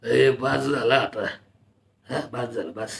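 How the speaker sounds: low, soft-spoken tired elder calling it off; tired old man saying enough; weary elder. Acted. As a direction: wave the party to a stop, low and quiet. Elder Rambhau calls a halt.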